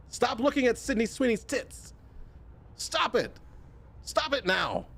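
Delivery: high, mock-stern scold. Streamer scolding chat, mock-stern high voice. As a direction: scold them like a prudish aunt.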